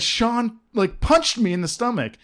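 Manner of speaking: high-pitched tattling whine; imitated crying. Crybaby classmate telling the teacher on Sean, mock tearful whine.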